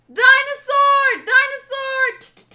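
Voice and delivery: high, drawn-out panicked scream; loud, slow-stretched shriek of alarm; screaming her lungs out. Acted. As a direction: scream it as if chased. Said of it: "screaming her lungs out".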